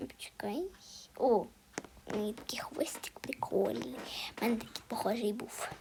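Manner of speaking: soft unvoiced whisper. Real, not acted. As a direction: hush the words, no voice. Шепоче коментуючи гру. (тихий шепіт)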